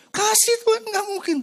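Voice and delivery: high, loud whimpering protest; crying child. Retold or imitated. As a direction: beg like a crying kid. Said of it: "Teriak-teriak kan".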